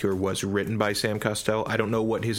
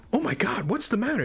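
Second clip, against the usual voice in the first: much higher mock wife voice; alarmed wife; 13 semitones higher than usual. Wife rushing in, voiced much higher in mock alarm. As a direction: squeak it as the startled wife.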